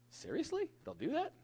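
high-pitched disbelief, rising sharply; incredulous. Retold or imitated. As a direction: question it in a squeaky, soft mock astonishment. New father reacts to doctor's advice.

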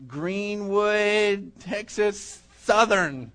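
slow, drawn-out imitation Texas Southern accent; playful Greenwood, Texas Southern twang; Southern belle accent. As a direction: stretch the words in a mock Southern belle accent. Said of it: "it sounded real Southern Belle".

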